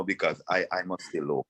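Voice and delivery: usual speaking voice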